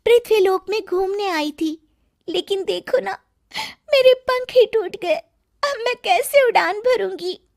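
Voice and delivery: high, sweet, plaintive fairy voice; a sad little fairy lamenting her broken wing; fairy queen. Acted. Fairy queen to Gauri, high and plaintive.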